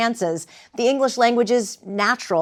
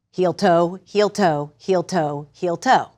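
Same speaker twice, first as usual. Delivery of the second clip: "heel toe" in evenly spaced pairs like paced steps; march out "heel toe", a short pause after each pair; footfall rhythm; slower than usual. Shown as a demonstration. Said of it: "a rhythm that we carry in our footfalls".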